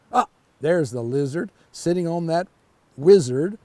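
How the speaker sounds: plain reading voice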